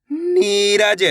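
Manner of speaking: Neeraj with a long, held नी; drawn-out first syllable. Shown as a demonstration. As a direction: stretch नी, then say रज. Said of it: "नी को खींचना है यहाँ पे".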